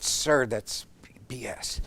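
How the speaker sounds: barely whispered, words hard to get out; hesitant whisper. Retold or imitated. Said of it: "I could hardly get the words out".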